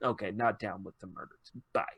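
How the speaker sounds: low, very soft backing out; hushed, low retold goodbye; mock meek exit. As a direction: mumble it softly as if slipping away. Girl backs out, voiced in retelling.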